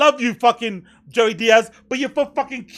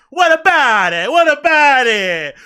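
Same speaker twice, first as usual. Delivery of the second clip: mock mobster; 6 dB louder than usual. Mock tough-guy Italian posturing.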